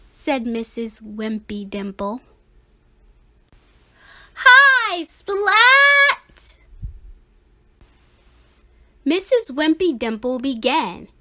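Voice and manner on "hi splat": loud high cheer; class greeting. A narrator's reading. The class greeting Splat, loud high cheer. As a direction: shout hello all together.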